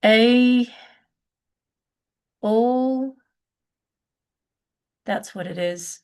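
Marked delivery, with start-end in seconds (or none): 0.0-3.2 drawn-out, halting recall; thinking aloud with long pauses; searching memory. Real, not acted. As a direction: stretch each word while trying to remember. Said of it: "got a complete mental blank".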